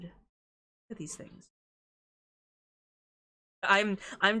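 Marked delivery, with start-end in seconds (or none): 0.0-1.5 whisper. Real, not acted. Cooing at cute birds on screen.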